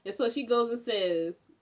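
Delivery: ordinary talk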